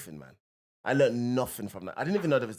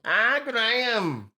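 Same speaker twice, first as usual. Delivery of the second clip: put-on slow, drawn-out Scouse accent, higher and louder; 8 semitones higher, 5 dB louder, much slower than usual. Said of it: "Was that your Scouse accent?".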